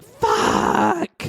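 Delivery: a man realizing he's stuck, dragging out a low dismayed curse. Retold or imitated. Fugitive realizing the ID is a dead man's, low drawn-out curse. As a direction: drop way down low and stretch the curse out. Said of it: "So now he's like".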